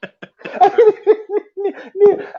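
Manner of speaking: real high cackle of laughter; high helpless laugh; high wheezing laugh. Real, not acted. Laughing at own story.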